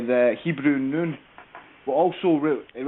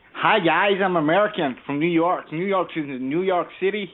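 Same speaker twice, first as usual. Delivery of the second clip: higher-pitched put-on American accent; 4 semitones higher than usual. Shown as a demonstration. Irish caller showing the host his best American accent. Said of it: "do your best California American accent".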